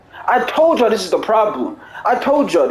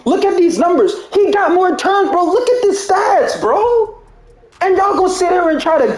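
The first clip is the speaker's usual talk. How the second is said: pitched way up, pressing the stats; heated rant; 8 semitones higher than usual. Real, not acted. Gamer showing viewers bad stats, heated and high. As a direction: voice climbing as he points at the numbers.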